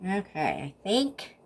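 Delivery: ordinary talk, nothing marked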